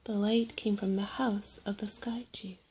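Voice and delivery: usual speaking voice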